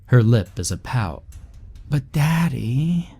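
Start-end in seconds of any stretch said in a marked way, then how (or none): 1.9-3.1 high, slow drawn-out whine; whining child. A narrator's reading. Little girl pleading with her father, whining. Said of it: "she whines".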